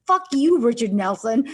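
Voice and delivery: a full-throated joking rage the director hopes for; big mock curse. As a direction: yell it at the playwright for his enjoyment. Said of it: "he's very disappointed if he doesn't get at least one".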